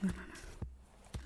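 terrified breathy whispering; panicked whisper. Acted.

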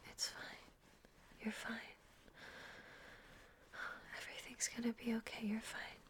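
soft, hushed reassurance; tender and quiet; soothing. Acted. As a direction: gently lull someone back to sleep. Soothing partner after a bad dream.

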